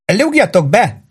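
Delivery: high, jubilant greeting; beaming welcome. A narrator's reading. Charlie greets arriving customers at his bar, beaming. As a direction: ring it out with beaming delight. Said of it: "sugárzott Charlie".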